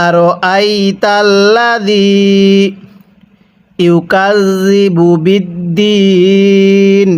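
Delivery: বিদ্দিন drawn out very slowly; slow recitation. Shown as a demonstration. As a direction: stretch বিদ্দিন in chant. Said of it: "হ্যাঁ, পড়ুন।". (বিদ্দিন খুব টেনে তিলাওয়াত)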